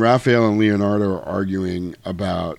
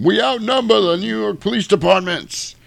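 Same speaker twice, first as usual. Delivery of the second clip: much higher mock rallying speech; Cyrus impression; 12 semitones higher than usual. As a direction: preach to the gangs like Cyrus. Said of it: "Cyrus from the Warriors when he's telling them".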